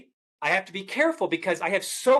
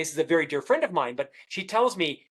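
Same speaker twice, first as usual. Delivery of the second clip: usual speaking voice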